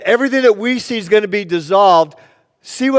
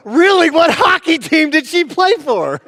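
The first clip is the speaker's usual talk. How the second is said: mock eager surprise; 11 semitones higher than usual. Clerk learns the owner's wife is from Lancaster, mock eager surprise. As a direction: blurt it out high to cover a blunder.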